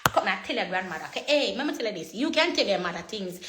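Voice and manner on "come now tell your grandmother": ordinary talk, nothing marked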